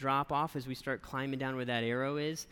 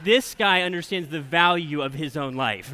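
laughing; 10 dB louder than usual. Real, not acted. Laughing at the dad's joke.

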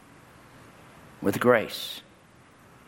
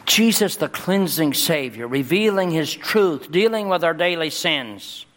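preaching at full force; 6 semitones higher than usual. Acted. Pastor proclaiming Jesus to his congregation, in full preaching cry. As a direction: lift the name of Jesus up to the rafters.

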